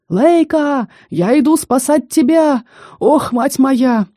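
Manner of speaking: высокий протяжный крик, не во всю силу; кричать издалека, спеша на помощь; крик спасителя. A narrator's reading. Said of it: "послышался крик Росса". (высокий сценический крик)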